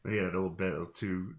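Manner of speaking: ordinary talk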